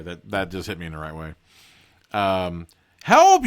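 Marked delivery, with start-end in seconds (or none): 3.0-3.4 very high, loud panicked yell; desperate high shout; crying for help. A narrator's reading. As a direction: scream for rescue at full voice. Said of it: "you cry".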